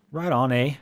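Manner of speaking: put-on Canadian accent, lightly done; mock Canadian accent. Gamer teasing his Canadian friend, mock Canadian accent. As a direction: play up the Canadian vowels on eh. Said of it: "working on my, uh, Canadian accent".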